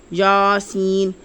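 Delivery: یاسین said slowly, the یا held twice as long; slow teaching recitation of یاسین; doubled vowel. Shown as a demonstration. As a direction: stretch the یا in یاسین for double length. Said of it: "یعنی کہ دگنا لمبا".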